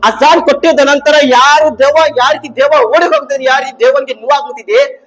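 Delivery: high, driving rhetorical rebuke; forceful preaching. Real, not acted. Preacher rebuking those who object to the azan, forceful preaching. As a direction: challenge them from the pulpit.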